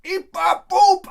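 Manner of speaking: squeaky high, loud gibberish voice; mock kid gibberish. Kid gets a sleeve of Oreos, hammed-up squeaky gibberish. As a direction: yelp it out like an excited little kid. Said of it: "He'd be like".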